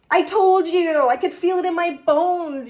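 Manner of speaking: delighted I-told-you-so; real excitement. Real, not acted. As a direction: crow that you called it. Card confirms her prediction.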